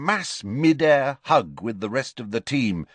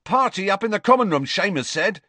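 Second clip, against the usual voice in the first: high, quick, excited call; exuberant yell; 7 semitones higher, faster than usual. A narrator's reading. Player rallying teammates to a victory party, exuberant yell. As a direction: shout out the party news. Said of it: "yelled Dean exuberantly".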